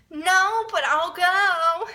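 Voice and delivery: high, loud mock wail; hammed-up distress, drawn out at the end; panicked reluctance. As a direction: whine it as if being dragged along. Acts out own panicked reluctance.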